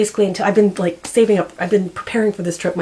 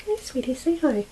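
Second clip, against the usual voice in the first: higher and softer, cooing at a pet; soft pet talk; 7 semitones higher, 6 dB quieter than usual. Real, not acted. To her sick cat on camera, soft high cooing. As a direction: coo gently to the cat.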